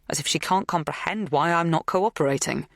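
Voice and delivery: ordinary delivery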